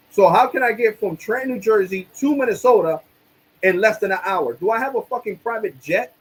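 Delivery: retold indignant, high-pitched sarcasm; sarcastic rant. Arguing with the bank about the charge, sarcastic. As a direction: pile up absurd questions in mock disbelief. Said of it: "you don't have to be so sarcastic".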